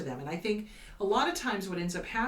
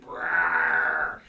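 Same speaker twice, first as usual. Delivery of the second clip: low growling rumble like an angry Hulk; 6 dB louder than usual. Retold or imitated. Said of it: "Incredible Hulk".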